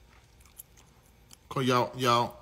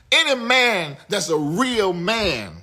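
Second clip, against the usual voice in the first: slow angry emphasis; 9 semitones higher, 7 dB louder, slower than usual. Real, not acted. Heated rant at men in chat.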